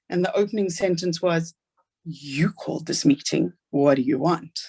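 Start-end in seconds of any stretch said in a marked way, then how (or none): 2.0-4.6 cold official. Retold or imitated. Hostile school official opening the meeting.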